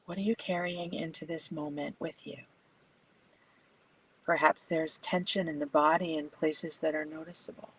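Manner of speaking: soft, unhurried meditation voice with long pauses; guided meditation. Acted. Yoga teacher guiding a bedtime class into stillness, soft meditation voice. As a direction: settle them gently into stillness, speaking softly.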